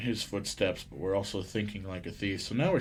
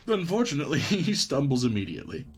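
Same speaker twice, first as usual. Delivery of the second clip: higher, breaking into a chuckle mid-sentence; amused, laughing; 4 semitones higher, faster than usual. Real, not acted. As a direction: read it while starting to crack up. Reading game line, cracking up.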